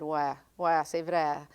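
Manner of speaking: ouais said Acadian style, flat "wa"; casual Acadian French "ouais, c'est vrai". Shown as a demonstration. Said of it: "Give me, give me an example".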